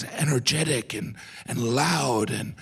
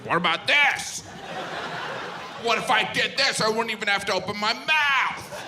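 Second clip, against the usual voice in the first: higher, showy dancer voice; mock flamenco dancer; 10 semitones higher than usual. Comic imitating flamenco dancers competing for noise, showy. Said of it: "It's like".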